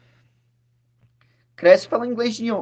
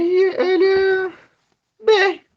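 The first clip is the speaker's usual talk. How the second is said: drawn-out exasperation; 13 semitones higher, 3 dB louder, much slower than usual. Real, not acted. Mocking ignorant Brazilians, searching for words.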